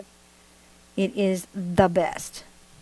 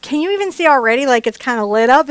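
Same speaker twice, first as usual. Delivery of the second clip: higher, louder, quick delight; excited; 5 semitones higher, 6 dB louder than usual. Real, not acted. Painter thrilled her paint glows, excited higher gush. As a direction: gush over the glowing hair. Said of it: "I get excited".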